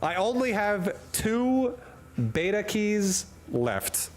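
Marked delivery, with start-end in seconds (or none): none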